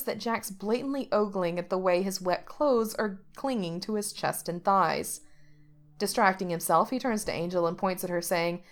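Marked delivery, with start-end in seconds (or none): none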